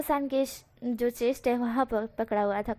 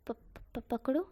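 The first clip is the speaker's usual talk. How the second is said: soft stammering breathy question; shy near-whisper to his crush; 7 dB quieter than usual. Acted. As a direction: stumble over it, embarrassed. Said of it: "वो शर्मा रहा था".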